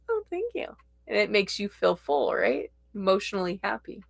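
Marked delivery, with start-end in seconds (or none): 0.1-0.8 flattered high thanks. Retold or imitated. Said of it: "And you go".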